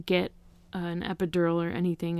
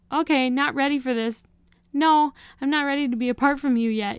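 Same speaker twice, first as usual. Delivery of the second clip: higher and louder, pleading; desperate plea; 7 semitones higher, 6 dB louder than usual. Retold or imitated. Mother pleading with her baby, higher and louder. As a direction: beg to hold on a little longer.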